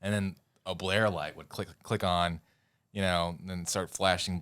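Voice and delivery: ordinary talk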